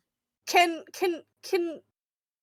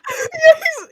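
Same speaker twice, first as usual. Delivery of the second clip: laughing. Real, not acted. Still laughing while answering.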